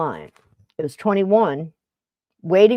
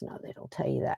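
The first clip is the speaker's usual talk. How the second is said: hushed confiding whisper; 7 semitones lower, 16 dB quieter than usual. Retold or imitated. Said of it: "And she says".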